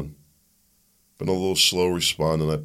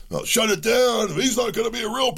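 mock Democrats. Host mocking Democrats reversing on shutdowns, high mock voice. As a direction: sneer it like a flip-flopping politician.

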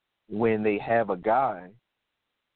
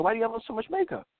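higher and fast, in disbelief; incredulous question; 10 semitones higher, faster than usual. Retold or imitated. Watching the show, asking about the clown makeup in disbelief. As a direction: rattle it off high and incredulous. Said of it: "I'm like".

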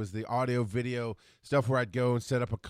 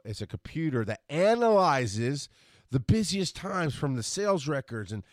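normal talking voice

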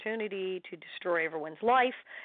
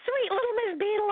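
high, sugary mock praise of Sweet little Miss Beadle; mock-sweet sing-song; 13 semitones higher than usual. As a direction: gush "Sweet little Miss Beadle" in a much higher, teasing voice. Mock sweet role name.

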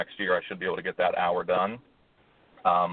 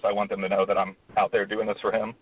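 normal talking voice

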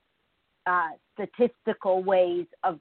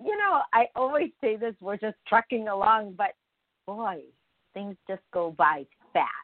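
normal talking voice

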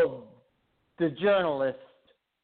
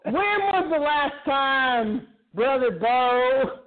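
cult leader; 5 semitones higher than usual. Acted. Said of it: "you sound like a fucking cult leader".

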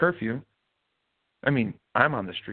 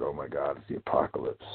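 mock panic; 8 semitones lower, 5 dB quieter than usual. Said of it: "everybody's freaking out".

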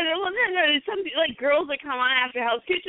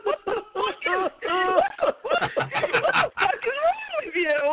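laughing mock yell, rising much higher; shocked fiance; 11 semitones higher than usual. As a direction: retell his startled outburst while cracking up. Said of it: "and he said".

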